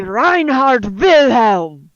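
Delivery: slow, hammed-up German accent; booming bad German, drawn out; Reinhardt Wilhelm. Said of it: "Be a bad German".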